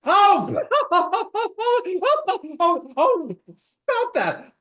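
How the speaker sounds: high, loud squealing laughter; being tickled. Shown as a demonstration. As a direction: laugh and squirm like you're tickled. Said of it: "Somebody's tickling you".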